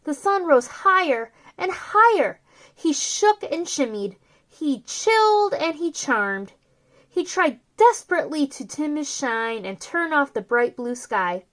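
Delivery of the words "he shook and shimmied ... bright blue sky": ordinary delivery, nothing marked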